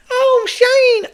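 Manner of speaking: very high, loud mock voice; exaggerated falsetto put-down; cunty imitation. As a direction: squeal it like a catty critic.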